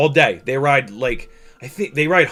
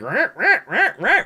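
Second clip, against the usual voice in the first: high rising drone-motor revs; repeated rasping "rah" engine noise; imitated throttle revving; 11 semitones higher, 3 dB louder than usual. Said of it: "you'll hear it going".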